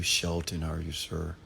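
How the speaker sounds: normal talking voice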